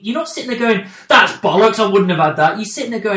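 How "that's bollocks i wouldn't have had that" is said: loud, scornful acted protest; mock indignation. Fan imitating a viewer dismissing the twist, loud mock indignation. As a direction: reject it loudly, as an outraged viewer would.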